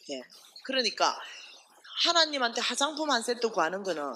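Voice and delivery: ordinary delivery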